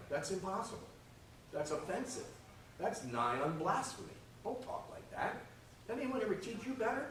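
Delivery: mock scolding.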